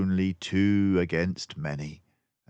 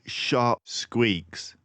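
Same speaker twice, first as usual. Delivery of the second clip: ordinary delivery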